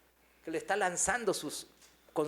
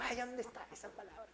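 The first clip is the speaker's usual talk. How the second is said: much softer, higher wondering aloud; puzzled inner voice; 5 semitones higher, 13 dB quieter than usual. Retold or imitated. Preacher recalls hunting for a verse, hushed puzzled self-talk. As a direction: act out a hushed search of memory. His own puzzled thought, acted out.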